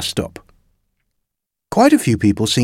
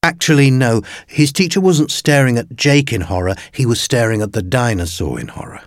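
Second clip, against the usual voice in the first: usual speaking voice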